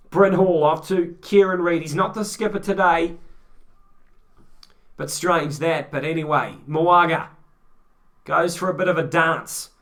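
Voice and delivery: lively sports commentator call; play-by-play commentary. Acted. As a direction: call the rugby action live. Live rugby play-by-play.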